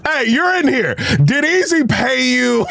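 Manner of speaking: high, laughing tease. Real, not acted. Joking that Easy paid him off, laughing high. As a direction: ask it while cracking up. Teasing question through laughter.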